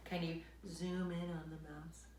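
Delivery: ordinary delivery, nothing marked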